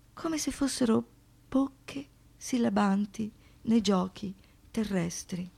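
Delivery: ordinary talk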